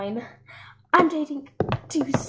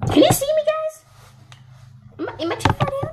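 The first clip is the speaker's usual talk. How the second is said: high calling out; 4 dB louder than usual. Acted. Doll calling out to the camera.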